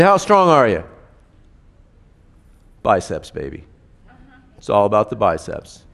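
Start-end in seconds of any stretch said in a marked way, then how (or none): 0.0-0.8 louder, higher coaxing question; asking a child. Retold or imitated. Parent asking a young child to flex, playful. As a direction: egg the kid on to show off.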